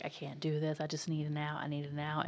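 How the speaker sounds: hushed, low retold pleading; soft, understated desperate muttering; panicked character's plea. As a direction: quietly act out her inner plea. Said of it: "moment where she's like".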